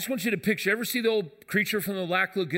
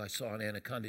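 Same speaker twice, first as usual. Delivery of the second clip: low and much softer, keeping a secret; mock-serious hushed news to a friend; hushed warning; 10 semitones lower, 12 dB quieter, faster than usual. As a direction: tell him quietly so nobody hears. Telling Jim mid-baptism.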